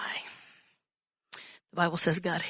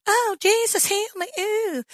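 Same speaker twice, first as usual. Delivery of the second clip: much higher, whiny fake plea; mocking a sick man; 13 semitones higher, 4 dB louder than usual. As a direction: ham up a pitiful beggar to mock him. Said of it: "Like somebody saying".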